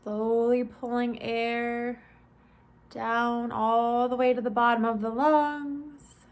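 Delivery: measured, spacious body-scan instruction; guided meditation. Acted. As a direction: ease listeners through each step, stretching the phrase endings.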